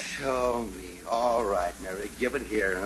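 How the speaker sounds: low, soft grumble; weary compliance. Acted. Husband taking glass of tea from wife, wearily. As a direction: give in with a tired sigh. Tired father gives in to wife.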